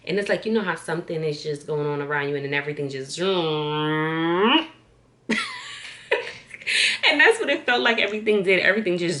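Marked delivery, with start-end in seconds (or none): 3.1-4.7 long drawn-out zoom, rising and getting louder; swooping zoom; zooming sound. Retold or imitated. Imitates everything zooming in. 5.3-7.0 high-pitched laughing fit; high squealing laugh. Real, not acted. Laughs at own sound effect.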